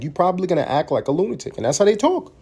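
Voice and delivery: normal talking voice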